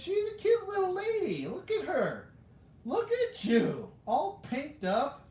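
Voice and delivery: put-on high, cooing baby talk; baby-talk gushing. Collector to the little lady figure, high gushing coo. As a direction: fuss over it in a squeaky, adoring coo.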